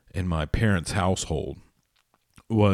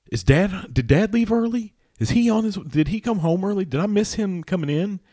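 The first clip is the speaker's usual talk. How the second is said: put-on anxious high kid voice; 13 semitones higher, 5 dB louder than usual. Kid anxiously asking mom on phone.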